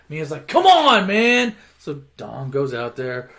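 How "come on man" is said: loud, high mock protest; exasperated shout. Urging Dom to deal with the fight, exasperated. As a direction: act out an exasperated appeal.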